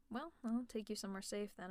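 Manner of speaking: ordinary talk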